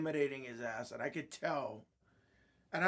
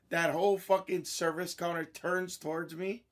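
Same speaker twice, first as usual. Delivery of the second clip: ordinary talk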